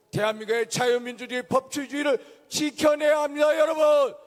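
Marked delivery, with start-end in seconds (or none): 2.5-4.1 rally cry, rising to a shout; rousing shout. Real, not acted. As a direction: shout it to the crowd. Rally call to crowd.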